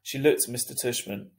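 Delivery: ordinary delivery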